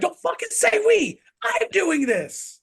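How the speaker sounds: high, loud mock rant; indignant high-pitched yell, drawn out at the end; exasperated outburst. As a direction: imitate a fed-up coach erupting. Imitating exasperated Jinxie snapping back.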